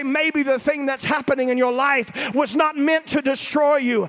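loud, rising sermon climax with high pitch; full-force preaching. Acted. Preacher exhorting the congregation, in full-force preaching. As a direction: preach it out over the music, slowing on God's handiwork.

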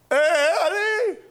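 high, loud call across the dark; calling for a missing friend. Retold or imitated. Buddies searching at night, calling loudly.

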